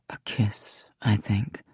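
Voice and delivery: intimate unvoiced whisper. A narrator's reading. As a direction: breathe it close to her ear.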